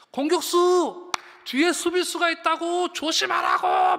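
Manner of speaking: loud, high-pitched warning yell; shouting spectators. Acted. Spectators warn the attacker of a defender behind, shouting. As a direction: yell it urgently from the stands. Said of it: "관중들이 막 소리 질러요".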